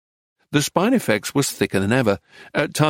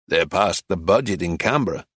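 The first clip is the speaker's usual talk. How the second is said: lower, flat and uninterested; flat, disinterested; 6 semitones lower than usual. A narrator's reading. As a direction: say it like it barely matters to him. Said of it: "in a flat, disinterested voice".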